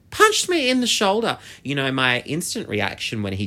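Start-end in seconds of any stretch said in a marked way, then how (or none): 0.1-1.4 much higher, louder incredulous exclamation; outraged disbelief. Real, not acted. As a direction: exclaim it high and loud, outraged. Repeats the punch in outrage.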